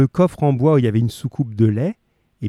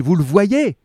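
higher urgent repeated question; Holmes crying out; 6 semitones higher, slower than usual. A narrator's reading. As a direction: press the question again, calling out. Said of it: "cria-t-il".

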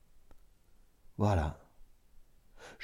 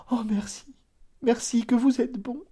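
much higher, relieved outburst of thanks; grateful cry; 14 semitones higher than usual. A narrator's reading. Cousin thanks narrator, high relieved cry. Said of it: "Elle s'écria".

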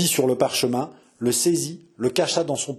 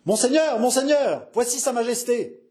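high-pitched breathless-style announcement; urgent cry; 11 semitones higher than usual. Acted. Man bursting in to announce his majesty, urgent cry. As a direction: call out the urgent news. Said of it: "un homme cria".

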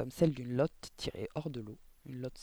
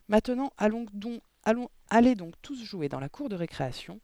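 brisk urging; 7 semitones higher, 5 dB louder than usual. A narrator's reading. Teacher sending children out.

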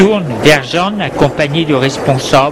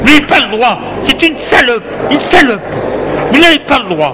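higher, angry protest while thrown out; mock shout; 9 semitones higher than usual. Man dragged from restaurant, protesting angrily. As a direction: yell it as he's dragged away. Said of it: "Il crie".

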